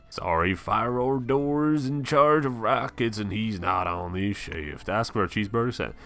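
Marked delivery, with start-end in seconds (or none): 0.1-4.9 storekeeper Calvin. Acted.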